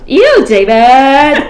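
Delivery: loud, high, very drawn-out mock disgust; Schitt's Creek character. Friend quoting a sitcom to her co-hosts, exaggerated impression. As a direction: stretch both words in a hammed-up TV impression. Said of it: "Or maybe that's Schitt's Creek".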